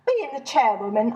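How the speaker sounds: ordinary delivery, nothing marked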